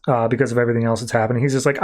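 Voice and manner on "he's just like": ordinary talk, nothing marked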